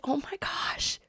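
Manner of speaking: breathless whispered oh my gosh; a stunned whisper of joy; hushed gasp. Retold or imitated. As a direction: mom whispering in disbelief. Mom's hushed reaction retold.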